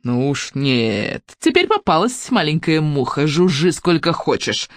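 slow, gloating, mocking crone; triumphant innkeeper. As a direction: savour the catch. Innkeeper triumphant over captive.

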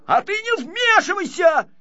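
very high, loud, angry outburst; heated squeal of temper; flaring up. A narrator's reading. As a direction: lash out at the intruder. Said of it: "вспылил ещё больше Гунька".

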